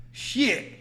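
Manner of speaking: high, loud verdict; hammed-up outrage after a taste; imitated indignant woman. Woman rejecting the drink after a big gulp, mock indignant. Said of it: "That is exactly what she said".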